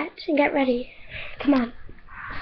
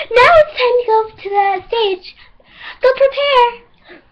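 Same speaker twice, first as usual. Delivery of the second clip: bossy stage judge; 8 semitones higher than usual. Pretend. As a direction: pretend judge, high and commanding.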